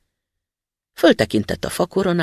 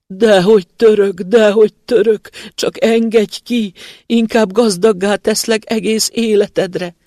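pleading spirit; 7 semitones higher, 8 dB louder than usual. Acted. Trapped spirit begs the student to free him: high pleading. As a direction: plead desperately from the bottle.

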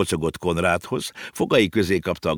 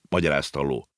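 usual speaking voice

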